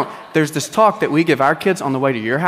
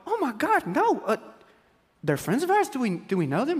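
much higher, softer, worried; mock alarmed; 7 semitones higher, 5 dB quieter than usual. Husband reacting in bed to grim news, high and alarmed. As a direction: gasp it out in panic. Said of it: "I'll go".

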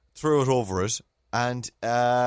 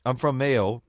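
usual speaking voice

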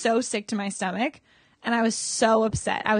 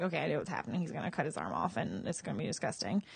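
softer, lower, resigned; quietly bracing herself; hushed inner thought; 5 semitones lower, 11 dB quieter, faster than usual. Retold or imitated. To herself, dreading the scene, hushed and resigned. Inner voice while avoiding gore.

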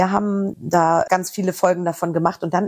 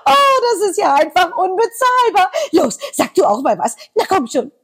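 very high, loud gloating cartoon voice; high gloating cartoon taunt; gleeful cartoon villain; 12 semitones higher, 4 dB louder than usual. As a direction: crow with delight over the swap. Voicing a cartoon character.

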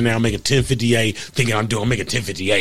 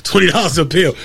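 laughing, pitch climbing high; 8 semitones higher than usual. Real, not acted. Admitting to the host his pill-selling, laughing. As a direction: crack up while telling it.

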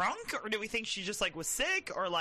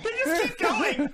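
laughing disbelief; 9 semitones higher, 8 dB louder than usual. Real, not acted.

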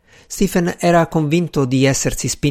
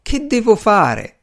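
high, slow wail of despair; plaintive, drawn-out moan in a raised voice; 9 semitones higher, slower than usual. A narrator's reading. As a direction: draw out the lament, pitched high. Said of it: "gemeva il gentiluomo".

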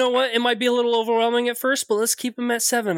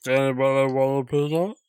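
much lower and quick, mock tough-guy; Vin Diesel voice; 7 semitones lower, faster than usual. Player offering pizza to the table in deep Vin voice. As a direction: ask for pizza in a deep action-star voice.

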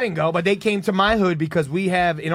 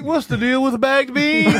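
high, slow, drawn-out mock observational bit; sitcom comedian parody; 7 semitones higher, 4 dB louder, slower than usual. Riffing on Seinfeld with friends, mock stand-up voice. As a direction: stretch it like a hammed-up stand-up opener.

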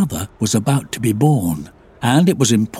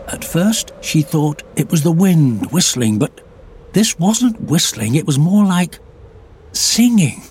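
plain reading voice

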